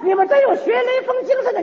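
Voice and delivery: high shout up to the crowd; calling out. Retold or imitated. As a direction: call up the pit wall to the people above. Calling up to people above.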